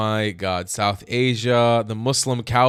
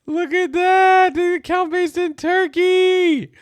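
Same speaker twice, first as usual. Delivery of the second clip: very high and slow, sing-song mockery; mocking high voice; 5 dB louder, slower than usual. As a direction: stretch it out high like you're taunting them. Mocking the account's location.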